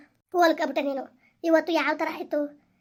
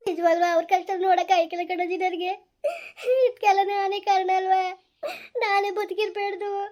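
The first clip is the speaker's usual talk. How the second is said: high crying wail; weeping, pitched up in despair; tearful self-blame; 5 semitones higher than usual. Acted. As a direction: sob out the guilt. Young woman weeps, blames herself.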